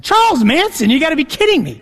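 high, loud disbelief; can't believe what he's hearing; incredulous shock. Retold or imitated. Retelling the death-threat letter to the audience, incredulous.

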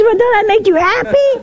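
hammed-up surprised question; high incredulous question. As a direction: ask it in bright disbelief. Preacher retells his question.

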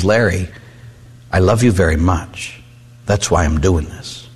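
low, softening reassurance; tender father. Retold or imitated. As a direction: gently explain tough love. Father reassures son after discipline.

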